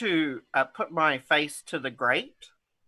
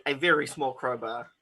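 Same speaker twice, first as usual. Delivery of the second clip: amused, breaking into laughter; laughing; 3 dB quieter than usual. Real, not acted. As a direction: say it through a suppressed laugh. Player cracks up at own item.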